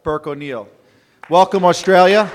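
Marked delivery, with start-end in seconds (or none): 1.3-2.3 big, louder hearty welcome to the room. Real, not acted. Host greets the Australian visitors in a loud welcome.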